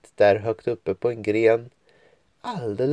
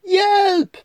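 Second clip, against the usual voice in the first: very high, far-off cry for help; 3 dB louder than usual. Acted. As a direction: call down from a treetop. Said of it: "en röst ropa".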